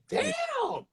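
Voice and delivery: high, drawn-out soft damn; amazed. Retold or imitated. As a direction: marvel in a high, stretched voice. Retelling his amazement at the laughs.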